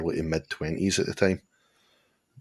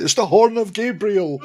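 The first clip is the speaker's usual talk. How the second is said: high, loud cry of superstitious wonder; mock awestruck villager; 14 semitones higher, 7 dB louder than usual. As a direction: proclaim it like an amazed yokel. Mimics villagers awed by a car horn.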